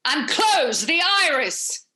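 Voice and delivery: lightly voiced commanding goddess; a hint of a regal goddess giving orders; Hathor goddess voice. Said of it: "I was gonna do it as Hathor".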